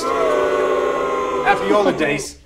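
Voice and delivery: long drawn-out shouted greeting; mock yell. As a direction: read it as a stretched-out yell. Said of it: "What are you yelling at Santa?".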